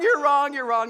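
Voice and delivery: loud repeated correction, easing off; loud insistence. Acted. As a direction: keep insisting loudly. Shouting down the retelling.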